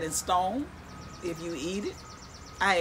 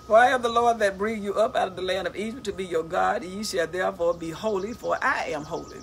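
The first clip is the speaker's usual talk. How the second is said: loud, proclaiming Bible reading; declaiming scripture from the book; 5 dB louder than usual. Acted. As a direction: read the verse out like a preacher. Reads scripture aloud.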